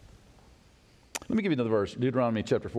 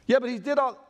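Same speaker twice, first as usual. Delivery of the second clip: indignant objector; 9 semitones higher, faster than usual. Pretend. Preacher voicing a doubter, higher and protesting.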